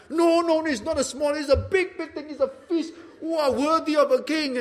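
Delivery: high-pitched, over-the-top protest of praise; mock-effusive guest insisting it's huge; gushing flattery. Gushing over a host's modest dish.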